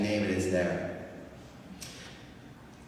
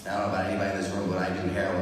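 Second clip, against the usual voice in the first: ordinary talk, nothing marked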